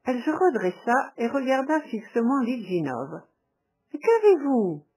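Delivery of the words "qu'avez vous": louder, higher alarmed exclamation; alarmed cry. A narrator's reading. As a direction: she cries out in sudden alarm. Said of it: "s'écria-t-elle".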